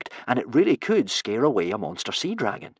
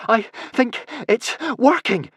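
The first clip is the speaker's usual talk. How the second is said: high, slow, panting words; breathless, high, effortful delivery; panted; 12 semitones higher, 3 dB louder than usual. A narrator's reading. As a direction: pant it out between breaths. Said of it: "panted Hiccup".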